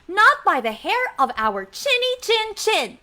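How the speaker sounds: high sing-song refusal; little pigs. Shown as a demonstration. Storyteller prompts kids to reply as pigs, high chant. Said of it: "kids, and say".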